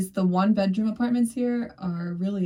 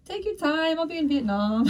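breezy high voice; 9 semitones higher than usual. Retold or imitated. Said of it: "I was like".

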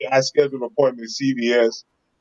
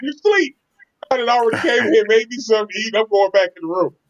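much higher mock wife voice, breezy dismissal; imitating wife; 9 semitones higher than usual. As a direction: play the wife, pitched way up, waving him off. Wife voiced, brushing him off.

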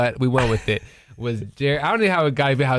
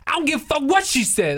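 retelling a friend's outburst, pitched much higher; mock yell; 12 semitones higher than usual. Podcaster mimicking angry Bobby yelling at him. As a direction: imitate him snapping angrily at you. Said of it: "he just starts yelling".

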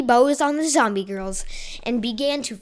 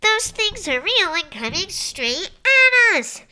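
alarmed character voice; 7 semitones higher than usual. Acted. Character warning of zombies.

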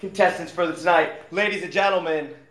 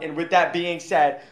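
ordinary talk, nothing marked

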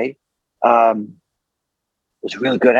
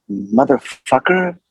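unfamiliar curse, stretched out slowly and higher; hesitant imitated swearing; 6 semitones higher, slower than usual. Naive actor asks his partner about a swear word, hesitant. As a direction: sound out the swear word unsurely, slow and raised. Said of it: "I don't speak like that".

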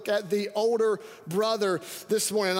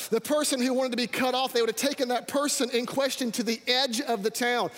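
normal talking voice

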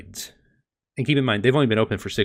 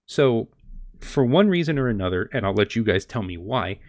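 ordinary talk

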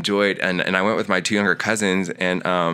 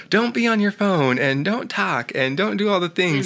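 high, sing-song announcer mimicry; mock announcer voice; 11 semitones higher than usual. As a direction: parody the cinema warning high up. Mocking the movie previews' rules.

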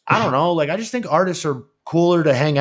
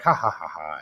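spoken ha-ha-ha, dropping low and soft; mock laugh; 7 semitones lower, 6 dB quieter than usual. Host retelling Denzel's same joke again, mock laugh. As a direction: imitate a friend's joking laugh, trailing away. Imitating friend's repeated podcast joke.

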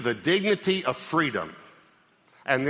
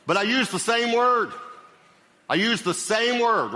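much higher and louder, driving it home; emphatic; 10 semitones higher, 4 dB louder than usual. Real, not acted. Pastor hammering his point to congregation, loud and high. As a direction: proclaim it to the back row.

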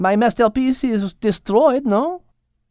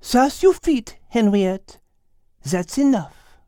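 calm, firm; 8 semitones higher, slower than usual. Acted. Cat tells Henriette to stop scolding, calm and firm.

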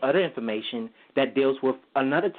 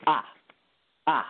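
short, sharp "ah"; quick, sharp Mandarin tone on "ah"; fourth tone; 2 semitones higher than usual. Shown as a demonstration. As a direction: tap "ah" quickly like a piano note. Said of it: "it's just very quick".

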